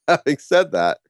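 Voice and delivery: amused at your own misfortune; real chuckling. Real, not acted. Chuckles after failed-date story.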